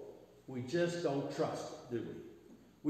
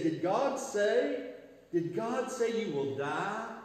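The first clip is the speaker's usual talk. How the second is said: serpent; 6 semitones higher, slower than usual. Retold or imitated. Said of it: "He said".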